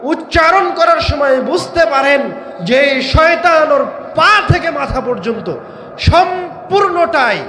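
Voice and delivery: rising sermon shout; preaching shout. Real, not acted. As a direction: thunder it from the pulpit. Preacher stresses Satan's evil.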